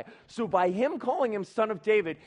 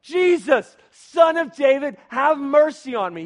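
high, very loud desperate-plea yell; full shout; 7 semitones higher, 12 dB louder than usual. Acted. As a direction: shout over everyone for mercy. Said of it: "He shouted all the more".

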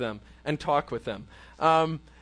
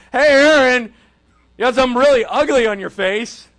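loud public call across the room; shouting it out; 3 semitones higher, 10 dB louder than usual. Retold or imitated. Pastor mocks a friend shouting it across the room, loud call. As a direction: shout it out for everyone to hear. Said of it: "shout it out".

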